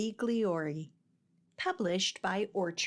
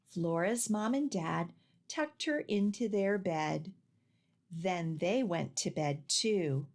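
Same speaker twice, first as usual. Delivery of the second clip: usual speaking voice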